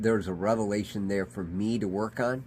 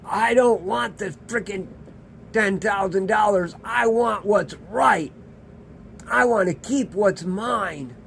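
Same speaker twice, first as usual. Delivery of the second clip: indignant outburst, high and loud; angry fool; 11 semitones higher, 6 dB louder than usual. Acted. The fool refusing a generous settlement in court, angry shouting. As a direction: dig in with a loud, high-pitched, stubborn refusal. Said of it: "And the fool says".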